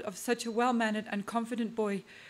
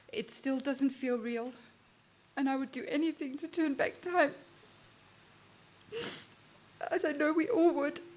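tearful, voice rising and cracking; fighting tears mid-sentence; voice breaking; 4 semitones higher than usual. Real, not acted.

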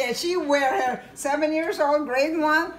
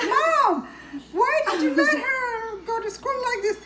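much higher, indignant disbelief; shocked outburst; 11 semitones higher than usual. Retold or imitated. Sister scolding mom over daughter's outfit, high and shocked.

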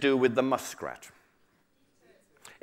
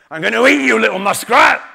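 loud, higher-pitched tiger threat; 7 semitones higher, 11 dB louder than usual. Acted. Hungry tiger to a little muskrat, loud threatening creature voice. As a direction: threaten your small prey loudly. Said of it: "said the tiger".

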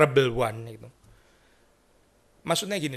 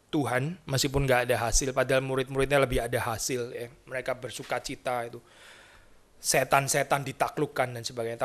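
ordinary talk, nothing marked

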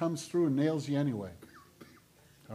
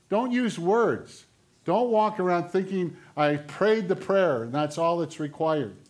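raised, punchy sermon voice; forceful preaching; 4 semitones higher, 6 dB louder than usual. Acted. Preacher warning the congregation against empty words, forceful. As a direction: drive the verse home to the congregation.

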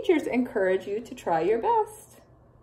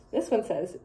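ordinary delivery, nothing marked

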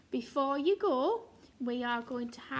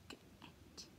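whispered sounds; 21 dB quieter than usual. Shown as a demonstration. Teacher whispering the word for children. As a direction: breathe "get." softly. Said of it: "teacher whispering sound buttons".

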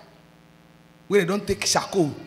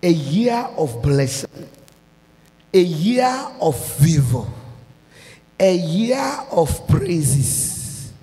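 drawn-out pronouncement of the coming year; slow preached declaration; slower than usual. Real, not acted. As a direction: lay out each blessing slowly, like a prophecy.

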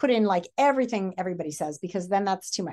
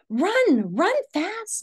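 high, urgent warning; urgent mother; 11 semitones higher, 5 dB louder, much slower than usual. Acted. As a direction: hurry him away in fear. Mother urges son in story.

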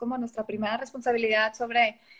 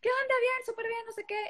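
high, bright put-on "all good" voice, fading softer; fake cheerful; 10 semitones higher, 4 dB quieter than usual. She fakes being fine when people ask how she is, high forced cheer. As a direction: pretend everything's fine while holding back tears. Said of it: "Y yo".

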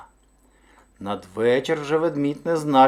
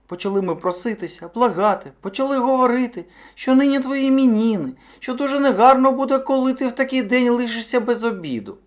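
very high, insistent little hare; high-pitched imploring hare; pleading hare; 11 semitones higher, 5 dB louder than usual. A narrator's reading. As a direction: beg earnestly in a high small voice. Hare retells their begging.

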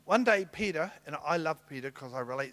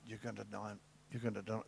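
soft warning; 6 semitones lower, 12 dB quieter, faster than usual. Acted. Jesus foretelling denial gently.

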